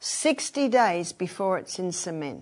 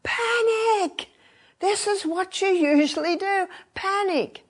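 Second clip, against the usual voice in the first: much higher, mock alarmed cry of the inner panic; screaming limbic system; 11 semitones higher, 4 dB louder than usual. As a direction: voice the brain yelling at you to panic. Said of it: "limbic system will be screaming at you".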